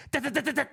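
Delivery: high rapid-fire da-da-da; imitated ball rattle. Player imitating the ball hitting the sling, imitated rattle.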